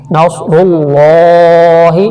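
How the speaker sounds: نصر اللہ slow, with a full heavy lam in اللہ; heavy lam in Allah. Shown as a demonstration. Teacher showing students a heavy lam in اللہ. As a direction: draw out نصر اللہ slowly, the lam in اللہ thick. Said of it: "موٹا کر کے پڑھیں گے".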